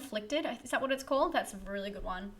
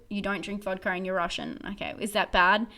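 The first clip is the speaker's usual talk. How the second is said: usual speaking voice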